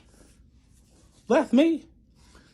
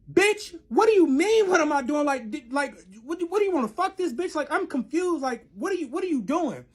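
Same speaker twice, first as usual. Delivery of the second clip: acted angry confrontation; 9 semitones higher than usual. Confronting boyfriend over the other girl, indignant and high-pitched.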